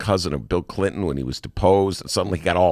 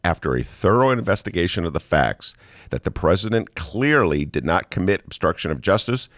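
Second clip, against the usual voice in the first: ordinary delivery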